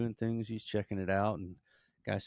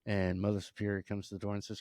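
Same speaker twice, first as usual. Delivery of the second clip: usual speaking voice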